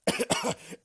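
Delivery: a deliberate little cough, clearing the throat on purpose; pointed fake cough. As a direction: cough pointedly before the name. Fake cough mid-narration.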